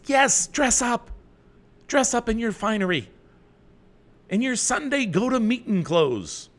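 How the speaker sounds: high, loud folksy rural Canadian codger voice; Charlie Farquharson. Acted. Host teasing the chat about finery in a hammed-up folksy voice. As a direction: ham up an old backwoods farmer. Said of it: "I'm channeling my Charlie Farquharson".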